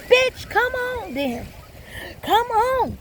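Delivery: high, drawn-out angry taunt; screaming mad. Retold or imitated. Woman answering her partner's threat, high angry yell. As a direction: yell it high like daring someone to fight. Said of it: "screaming, acting like I was going mad".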